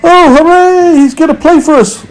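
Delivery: high, bright cheer from the crowd, quick; excited cry. A narrator's reading. Insect company cheering the grasshopper, excited high cry. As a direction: cry out in delight. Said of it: "they cried".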